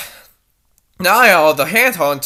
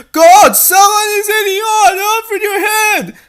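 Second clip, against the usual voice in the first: high, loud panicked yell; alarmed shout; 8 dB louder than usual. Acted. Dee Dee shouts to Niall about an intruder.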